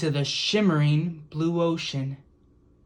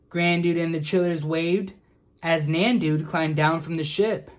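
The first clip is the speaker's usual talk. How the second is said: ordinary delivery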